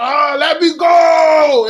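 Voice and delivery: loud, drawn-out demon wail; angry demon. Acted. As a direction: yell it and stretch the last word. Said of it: "the demon was, like, so angry".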